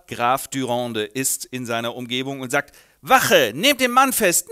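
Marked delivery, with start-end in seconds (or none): 3.0-4.5 loud command to the guards; commanding call. Acted. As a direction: order them sharply.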